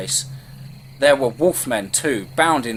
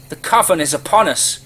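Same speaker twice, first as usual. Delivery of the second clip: louder, higher cry of alarm; alarmed shout; 4 semitones higher, 5 dB louder than usual. A narrator's reading. Resident warning the town of the coven, alarmed shout. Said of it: "with the shouts".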